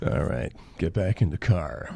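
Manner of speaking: low, dry Nicholson order; Jack Nicholson as Doc Brown. Acted. Doc Brown as Nicholson tells Marty to get in the car. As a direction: mutter it like Nicholson. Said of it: "Jack Nicholson is Doc Brown".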